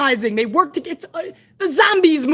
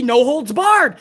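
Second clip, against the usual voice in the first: usual speaking voice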